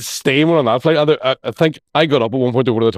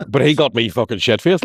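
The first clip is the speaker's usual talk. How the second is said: ordinary talk, nothing marked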